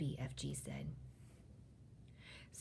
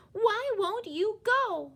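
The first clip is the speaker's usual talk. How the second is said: high, loud, drawn-out demand; slow, high-pitched, insistent question; pleading cry; 8 dB louder, slower than usual. A narrator's reading. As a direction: cry out in frustration. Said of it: "Sophie cried".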